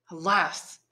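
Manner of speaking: ordinary delivery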